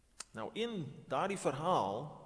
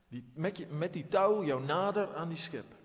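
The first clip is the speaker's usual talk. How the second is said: normal talking voice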